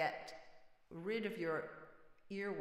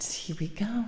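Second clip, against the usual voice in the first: whispering; 10 dB louder than usual. Real, not acted. Singer psyching up before song.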